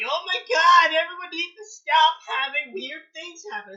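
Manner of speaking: high, loud mocking shriek; mock Yang outburst. Friend mocking Yang's whining, loud high mock shriek. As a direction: squeal it like an exasperated girl.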